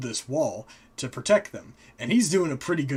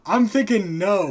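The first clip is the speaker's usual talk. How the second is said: higher, louder, defiant call; defiant Frigg; 6 semitones higher, 8 dB louder than usual. Retold or imitated. Wife calling in from outside, loud defiant refusal. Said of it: "She's like".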